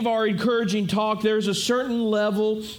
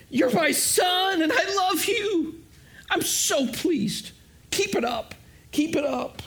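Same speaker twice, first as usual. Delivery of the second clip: loving father; 7 semitones higher than usual. Retold or imitated. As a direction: reassure him gently like a proud dad. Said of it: "the loving words of his dad".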